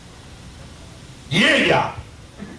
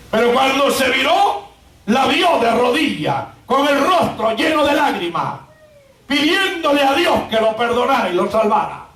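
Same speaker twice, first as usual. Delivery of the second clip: forceful pulpit storytelling; full-force preaching; 7 semitones higher than usual. Acted. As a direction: preach it out in driven, ringing phrases.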